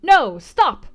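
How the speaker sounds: high, loud sudden stop; interrupting shout. A narrator's reading. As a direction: cut them off sharply. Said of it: "interrupted Colonel Dent".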